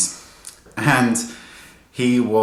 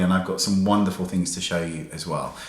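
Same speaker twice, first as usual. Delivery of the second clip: ordinary talk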